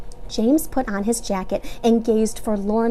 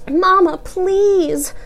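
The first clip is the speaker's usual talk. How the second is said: pleading; 10 semitones higher, 5 dB louder, much slower than usual. A narrator's reading. Marvin begs his mother.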